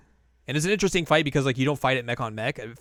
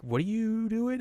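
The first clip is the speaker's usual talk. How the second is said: mock alarmed question; 10 semitones higher than usual. Vita alarmed at Rufus.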